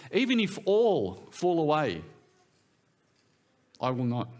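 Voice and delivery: firm, deliberate declaration; direct. Acted. Peter vows loyalty to Jesus, direct and firm. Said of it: "they're pretty direct".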